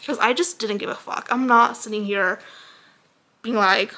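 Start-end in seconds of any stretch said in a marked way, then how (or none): none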